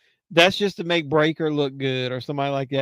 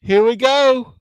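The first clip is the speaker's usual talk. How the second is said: excited; 8 semitones higher, 8 dB louder, slower than usual. Real, not acted. Excited reaction to match.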